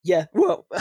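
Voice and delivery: a sudden break in the voice; voice cracking. Real, not acted. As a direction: voice cracks mid-word. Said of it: "that was a weird voice break".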